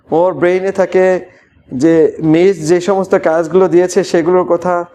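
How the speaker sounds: ordinary talk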